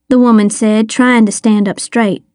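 ordinary delivery

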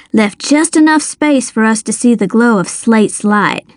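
ordinary delivery

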